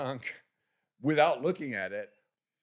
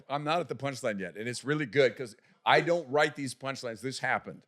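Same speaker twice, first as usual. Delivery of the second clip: normal talking voice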